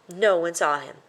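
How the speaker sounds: ordinary delivery, nothing marked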